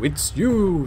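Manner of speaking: imitating a lottery advert, high swooping "you"; booming slogan voice. Commentator quotes the National Lottery slogan, booming announcer voice. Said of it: "a booming voice would go".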